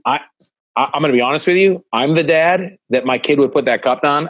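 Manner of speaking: ordinary delivery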